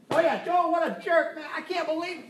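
high, loud jeering gossip voice; hammed-up gossiping pal; mock gossip. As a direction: sneer along with the crowd about Joe. Mocking gossip joining in.

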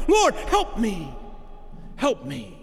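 a pleading mother begging for help; desperate plea. Acted. Preacher voicing a suffering mother, pleading. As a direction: cry out to the Lord, then drop to a broken plea.

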